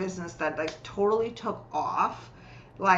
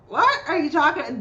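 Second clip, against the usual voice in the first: high, loud retold disbelief; incredulous; 8 semitones higher, 6 dB louder than usual. Coach recalling her reply to a client, incredulous. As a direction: act out a baffled, high-pitched reaction.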